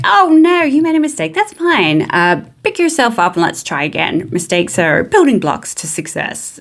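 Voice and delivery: high, bright reassurance that tapers back down; encouraging parent. Shown as a demonstration. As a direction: soothe yourself like a kind parent after a mistake. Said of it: "it's more like".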